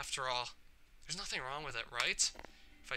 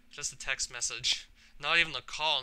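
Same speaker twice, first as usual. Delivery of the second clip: usual speaking voice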